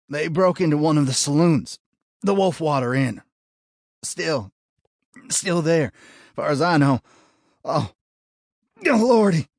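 high, loud, strained gasps; wounded man forcing words out; pained gasping. A narrator's reading. Dying deputy reports.

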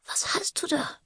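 put-on high, soft child's whisper. A narrator's reading. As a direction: ask it in a small, high whisper. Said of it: "Wisperte sie". (hohes, leises Wispern)

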